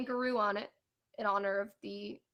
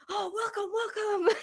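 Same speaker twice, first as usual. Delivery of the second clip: higher, lightly laughing eager welcome; excited owner; 10 semitones higher, slower than usual. Retold or imitated. Imitating restaurant owner greeting guests, eager welcome. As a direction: lightly imitate a thrilled host waving guests in.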